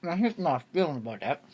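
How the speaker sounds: talking with a mouth full of snack cake; chewing while talking, words round a bite. Real, not acted. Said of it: "You're not supposed to speak with your mouth full".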